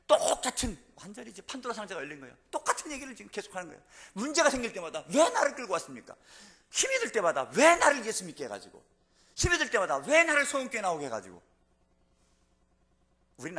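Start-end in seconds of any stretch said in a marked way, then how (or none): 0.1-11.4 high, loud sermon cry; impassioned preaching. Acted. Preacher mimicking Israel's complaints to the congregation, impassioned. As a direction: preach the people's repeated complaints at full force.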